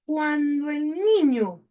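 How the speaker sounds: slow, rising then falling "Cuando el niño"; drawn-out reading for the class; slow read aloud. Shown as a demonstration. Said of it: "Dice lo siguiente".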